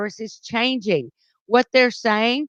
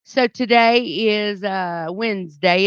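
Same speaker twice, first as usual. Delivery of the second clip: normal talking voice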